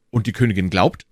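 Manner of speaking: usual speaking voice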